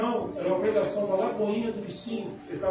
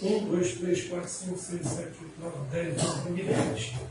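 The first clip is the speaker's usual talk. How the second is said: much softer, lower head count; counting under breath; 3 semitones lower, 6 dB quieter, slower than usual. Real, not acted. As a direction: count people off quietly to yourself. Counting heads in the congregation.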